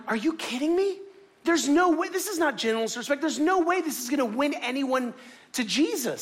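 high-pitched, indignant disbelief; incredulous protest. Retold or imitated. Retells disbelief at the signs.

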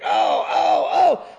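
high, loud cries of pain and shock; panicked cries. Retold or imitated. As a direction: cry out oh oh as the blood rushes. Dad shot, panicked cries.

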